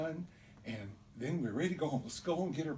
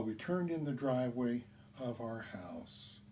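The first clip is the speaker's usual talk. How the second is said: ordinary delivery, nothing marked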